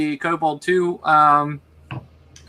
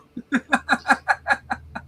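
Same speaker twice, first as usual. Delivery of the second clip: high, soft giggle; real high-pitched laugh; long run of rapid giggles; 9 semitones higher, 4 dB quieter than usual. Real, not acted. Game master amused by own game.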